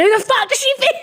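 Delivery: high-pitched mock outrage; hammed-up, very high indignant squawk; imitated angry outburst. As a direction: mimic her kicking off, voice shooting right up. Retold drunk woman's angry outburst.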